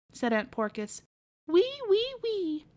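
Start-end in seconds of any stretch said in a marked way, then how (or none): 1.5-2.7 piglet squeak. Acted. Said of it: "answered Robinson".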